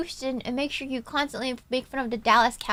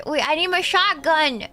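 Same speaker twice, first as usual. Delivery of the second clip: normal talking voice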